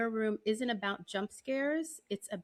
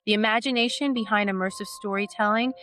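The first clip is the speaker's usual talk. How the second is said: polished announcer read over music, louder than her chat; podcast intro read; 8 dB louder than usual. Acted. Host opening her horror podcast for listeners, smooth announcer read. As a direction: present the show like a radio host, warm and measured.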